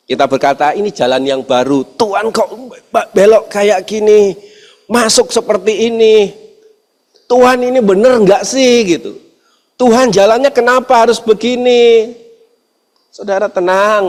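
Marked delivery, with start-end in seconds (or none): 0.7-12.2 complaining to God. Retold or imitated.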